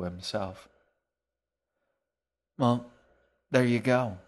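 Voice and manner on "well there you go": higher and louder, bright and cheery; almost cheerful. A narrator's reading. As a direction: toss it off with sudden cheer. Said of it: "He sounded almost cheerful".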